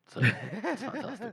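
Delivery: giggling high and slow; laughing. Real, not acted. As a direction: laugh it out, amused. Laughs at his own joke.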